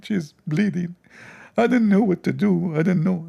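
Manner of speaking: high whiny begging to Allah; hammed-up high pleading; mock pleading prayer. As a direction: beg like a desperate man. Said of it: "he invoked Allah".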